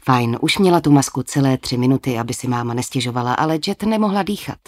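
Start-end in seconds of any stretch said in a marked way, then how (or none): none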